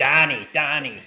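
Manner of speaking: put-on Boston accent, slow and high. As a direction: drag out Donnie in a Boston accent. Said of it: "Boston accent".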